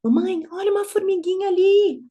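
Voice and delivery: put-on high-pitched little girl pointing something out; imitated excited child. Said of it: "falar umas coisas lógicas, tipo".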